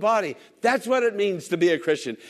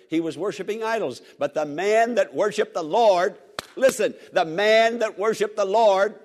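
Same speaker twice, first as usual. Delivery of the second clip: normal talking voice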